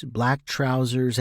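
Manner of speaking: ordinary delivery, nothing marked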